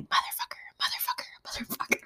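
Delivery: imitated furtive child's whisper. Student cussing while facing the other way, whisper. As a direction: whisper it repeatedly, no voice at all. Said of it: "he was going".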